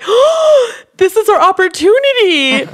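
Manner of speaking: high, loud excited gasp; light up as if it's a sign; sudden realization. Retold or imitated. Telling the group how she saw her chance, high and excited. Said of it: "And then I'm like".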